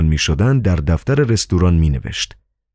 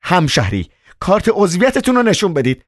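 much higher, stern insistence; insistent; 12 semitones higher than usual. Acted. Doorwoman demands the card again, much higher. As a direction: demand the card once more. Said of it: "زن باز گفت".

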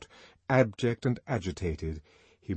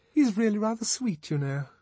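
Lenina's gushing; 13 semitones higher than usual. A narrator's reading. Lenina quoted about songster.

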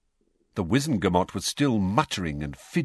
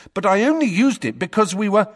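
loud, high angry protest; 13 semitones higher, 6 dB louder than usual. A narrator's reading. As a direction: push back hard, angry. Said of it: "said Harry angrily".

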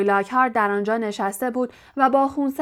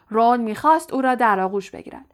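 plain reading voice

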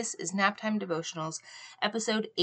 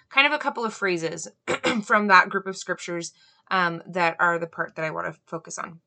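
ordinary delivery

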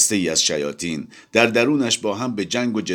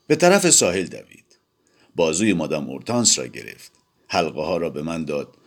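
usual speaking voice

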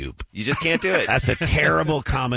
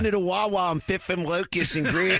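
put-on higher-pitched Philly accent; 3 semitones higher than usual. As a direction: lay on a thick Philly accent, as a local would. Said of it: "you know the Philly accent".

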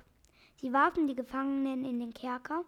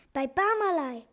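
louder, appalled question; horrified cry; 3 semitones higher, 6 dB louder than usual. A narrator's reading. Doctor learns he is at Barmaley's, horrified. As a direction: cry it out in dismay. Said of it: "rief der Doktor entsetzt".

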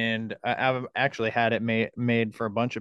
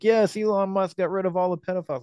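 high-pitched imitation of gullible fans; mock enthusiasm; 9 semitones higher, faster than usual. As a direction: parody an easily impressed crowd, pitched way up. Mimicking gullible fans cheering.

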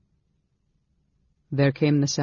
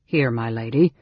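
awkward; 3 semitones lower than usual. Acted. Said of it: "the big man said awkwardly".

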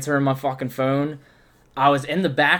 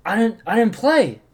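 high, loud retold confession; hammed-up panic, pitch raised; mock-distraught confession; 9 semitones higher, 5 dB louder than usual. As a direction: blurt it out as if horrified. Retold distraught confession of missing set.

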